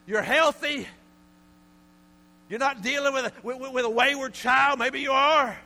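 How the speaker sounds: loud, high, rising preacher's call; preaching at full force. Real, not acted. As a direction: hammer each question at the congregation.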